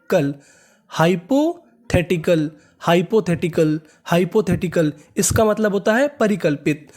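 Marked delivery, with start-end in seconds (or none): 0.9-4.9 hypothetical drawn out syllable by syllable; slow, careful demo. Shown as a demonstration. Teacher slowly pronounces hypothetical for students. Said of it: "इसे पढ़ेंगे".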